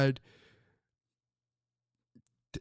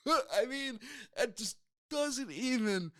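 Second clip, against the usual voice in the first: laughing; 10 semitones higher, 7 dB quieter than usual. Real, not acted. Laughing at the silly catchphrase.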